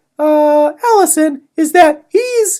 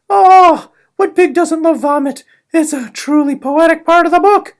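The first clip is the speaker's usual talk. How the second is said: ordinary talk, nothing marked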